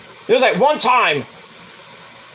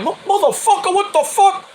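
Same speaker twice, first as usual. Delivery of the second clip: much higher mock Piazza outrage; imitating Piazza; 13 semitones higher than usual. Imitating Piazza yelling at Clemens, in a much higher mock voice. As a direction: squeak it out like an indignant catcher. Piazza reacting to thrown bat.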